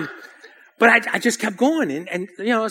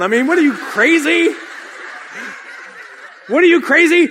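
raised, high incredulous questions; indignant disbelief; 6 semitones higher than usual. Retold or imitated. Teenage runner balks at coach's order, indignant. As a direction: challenge him as if he's lost it.

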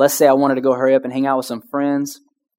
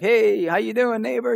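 cheery neighbor greeting; 7 semitones higher than usual. Retold or imitated. Neighbor calling across the lawn, cheery sing-song hello. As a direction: wave and call a friendly hi across the yards.

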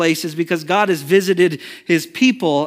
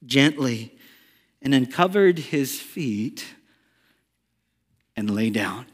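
soft, gentle narration; 5 semitones lower, 7 dB quieter than usual. A narrator's reading. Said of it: "she came softly, gently".

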